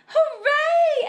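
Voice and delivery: high, loud cheering cry; excited shout. A narrator's reading. Whole class hears about the picnic, joyful shout. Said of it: "everyone shouted".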